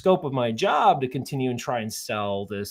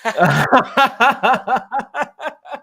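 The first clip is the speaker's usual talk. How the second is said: high bright laugh; 12 semitones higher, 7 dB louder than usual. Real, not acted. Laughing at her own front-porch joke, high giggle.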